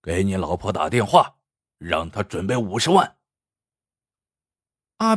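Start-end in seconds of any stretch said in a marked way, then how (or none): 0.0-3.2 menacing kidnapper. Acted. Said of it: "瞪着眼珠说".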